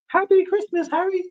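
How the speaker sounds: very high squeaky voice; high squeaky Harry Potter quote. Acted. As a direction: squeak out the greeting like a young boy.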